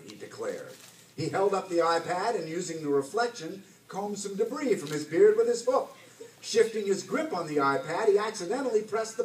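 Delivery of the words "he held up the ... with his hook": ordinary delivery, nothing marked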